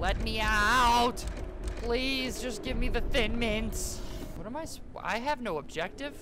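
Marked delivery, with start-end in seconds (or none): 0.0-4.0 loud, drawn-out begging wail; slow, raised-voice whine for mercy; mock desperate pleading. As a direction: plead to be released, hammed up. Begging to be let out.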